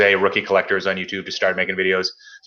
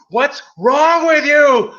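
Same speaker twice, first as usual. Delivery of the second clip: much higher, louder, slow exaggerated squeal; mock exasperated yell; 6 dB louder, much slower than usual. Ranting at the shop owner, hammed-up high yell. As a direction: wail it in mock disbelief.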